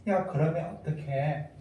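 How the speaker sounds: slow, soft grumbling whine; mock a griping complainer; grumbling complaint. Preacher mimicking a complaining believer, soft sulky whine. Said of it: "원망하는데".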